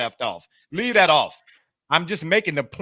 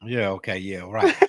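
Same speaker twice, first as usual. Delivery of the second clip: ordinary delivery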